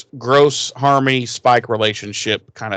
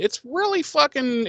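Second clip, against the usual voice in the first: ordinary talk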